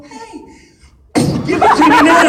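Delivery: sudden comic yell after a gentle opening; mock-polite turned shout. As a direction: start polite, then blast the order. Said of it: "just kinda politely walk up and go".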